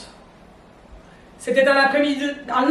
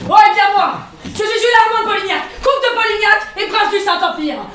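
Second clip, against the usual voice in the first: pompous boast; 6 semitones higher, 4 dB louder than usual. Acted. Count boasting his titles.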